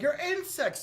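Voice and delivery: high contemptuous jab at the heroes; sneering villain. Retold or imitated. Zod belittling the trapped heroes, high scornful jab. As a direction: look down on them as bugs. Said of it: "And he's like".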